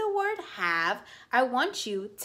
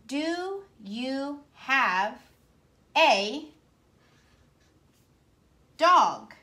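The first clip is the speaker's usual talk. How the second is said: slow and drawn out, louder on dog; slow rereading; 3 semitones higher, 3 dB louder, much slower than usual. Shown as a demonstration. Teacher modelling a slow reread for the class. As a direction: read it word by word, slowly. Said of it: "stretch it out".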